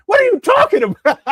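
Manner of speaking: very high, loud, laughing what; high laughing disbelief; laughing squeal. Real, not acted.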